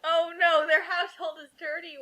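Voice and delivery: usual speaking voice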